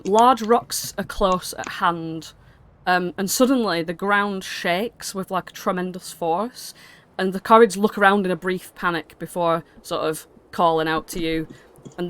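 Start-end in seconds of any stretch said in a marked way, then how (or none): none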